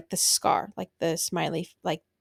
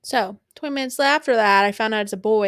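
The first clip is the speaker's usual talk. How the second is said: ordinary talk, nothing marked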